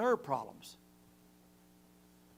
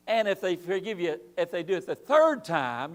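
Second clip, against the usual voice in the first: ordinary delivery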